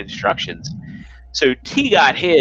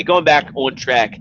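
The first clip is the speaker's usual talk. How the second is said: ordinary talk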